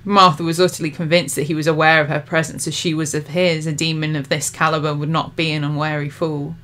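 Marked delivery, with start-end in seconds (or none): none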